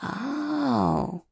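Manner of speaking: high, drawn-out arc of understanding; dawning realization. Acted. Partner explains the secret code, dawning realization.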